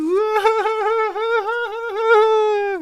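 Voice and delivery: long, very high cackle; drawn-out crazed high laughter; maniacal laugh. Pretend. Laughs madly after "I don't know.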